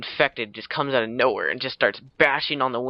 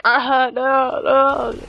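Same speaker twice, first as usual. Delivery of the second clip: high, loud imitation of a horrified cry; horrified mock scream; 12 semitones higher, 6 dB louder, much slower than usual. Guy watching his friend's head explode, horrified mock scream. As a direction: wail in mock horror.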